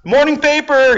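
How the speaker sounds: high, loud street-seller call; calling out to passing crowds; newsboy yell. Retold or imitated. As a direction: shout it like a paperboy hawking papers. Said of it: "trying to sell newspapers and you're like".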